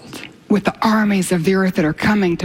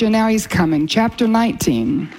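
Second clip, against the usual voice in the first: ordinary delivery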